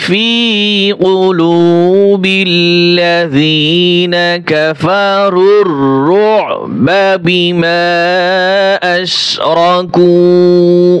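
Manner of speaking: slow melodic tajweed chant, long held vowels; Quran recitation. Acted. Teacher reciting the verse for students, slow chant. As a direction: recite each word slowly, stretching the vowels.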